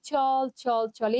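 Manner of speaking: "চল, চল, চল" drawn out without the rousing beat; the wrong, unenergetic way to recite "চল". Shown as a demonstration. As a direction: say each "চল" long and unhurried. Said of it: "এটা না পড়ে যদি আমি বলি".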